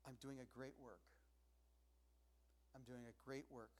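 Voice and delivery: softly, almost to himself; a hushed vow over a sleeping child; quiet resolve. Retold or imitated. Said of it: "just to be able to say".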